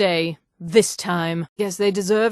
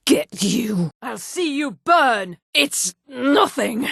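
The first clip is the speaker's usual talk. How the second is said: normal talking voice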